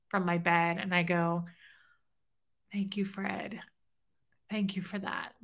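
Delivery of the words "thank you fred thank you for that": soft, half-whispered gratitude; hushed thanks. Retold or imitated. Widow thanking him from bed after the dream, hushed. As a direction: say it under your breath, softly. Retold private thanks on waking.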